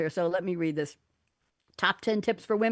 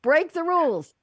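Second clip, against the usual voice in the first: loud restart; 10 semitones higher, 8 dB louder than usual. Shown as a demonstration. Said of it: "Okay, final words".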